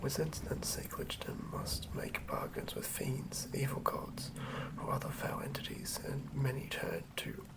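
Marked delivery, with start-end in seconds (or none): none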